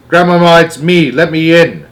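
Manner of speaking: loud, high urgent call. A narrator's reading. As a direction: call through the door urgently. Boy calling through door.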